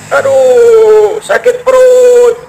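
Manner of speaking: high, slow groan of a man with a stomach ache; pained high moan, stretched out. Acted. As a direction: drag it out like a sick man whimpering. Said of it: "Dengar orang sedang mengerang, mengeluh, merintih".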